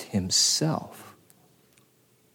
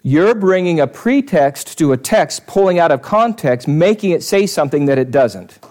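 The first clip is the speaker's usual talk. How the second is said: mock rebuke; 5 semitones higher, 4 dB louder than usual. Preacher rebukes the objector.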